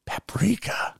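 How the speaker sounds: puzzled whispered echo. Real, not acted. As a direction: breathe the word in disbelief. Echoing the odd ingredient.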